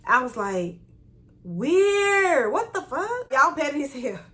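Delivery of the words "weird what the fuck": high, incredulous retold exclamation; weirded-out reaction. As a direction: draw back in high-pitched disbelief. Reacts to coworker parking beside her.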